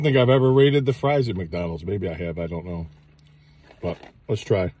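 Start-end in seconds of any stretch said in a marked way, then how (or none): none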